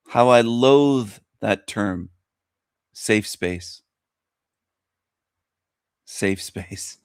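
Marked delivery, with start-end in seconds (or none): 2.9-7.0 low, soft, disdainful repeat; sneering under the breath; scornful muttering. Real, not acted. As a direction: drop it with contempt. Mocking a term he loathes.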